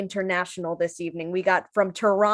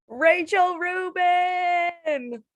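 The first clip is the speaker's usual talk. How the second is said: long drawn-out high call of the name; announcer call-out; 8 semitones higher, much slower than usual. Real, not acted. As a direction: stretch the name out like a host. Host calls out comic's name.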